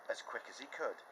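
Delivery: usual speaking voice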